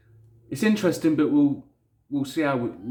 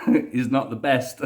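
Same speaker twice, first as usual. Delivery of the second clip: laughing; 3 semitones higher, 5 dB louder, slower than usual. Real, not acted. Laughing at his own speaking.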